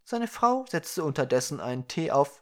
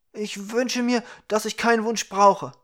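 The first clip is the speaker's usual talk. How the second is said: high-pitched dwarf character; 8 semitones higher, 7 dB louder than usual. A narrator's reading. Dwarf making a clever wish to the Ukra, high-pitched. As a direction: lift the voice high for the little dwarf.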